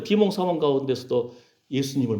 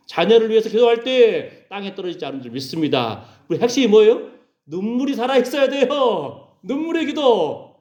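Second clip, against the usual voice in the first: high, forceful pulpit cry; impassioned, raised preacher's voice; fervent preaching; 7 semitones higher, 4 dB louder than usual. Real, not acted.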